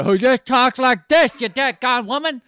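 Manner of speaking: hammed-up drunken bellow with garbled words; mock a yelling, mumbling movie drunk; Marlon Brando. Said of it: "When you got Marlon Brando".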